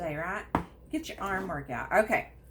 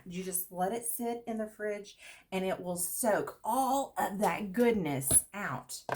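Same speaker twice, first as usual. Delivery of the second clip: usual speaking voice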